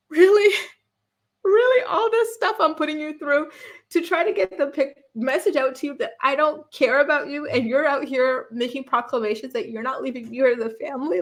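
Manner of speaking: hammed-up husband in disbelief; mock exasperated Cody. Mocking Cody fuming at his wife, high exasperated whine. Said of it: "He's like".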